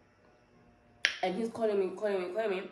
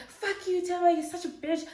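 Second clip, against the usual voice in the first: very high-pitched imitation of cursing; mock angry swearing; 12 semitones higher, 3 dB louder than usual. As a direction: hurl the insult in a high mocking voice. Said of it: "then swearing at me".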